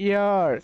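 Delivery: "ইয়ার" with no H at the start; silent H. Shown as a demonstration. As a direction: begin "ইয়ার" directly on the vowel sound. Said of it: "এর উচ্চারণ হচ্ছে". ("ইয়ার" এইচ উচ্চারণ না করে)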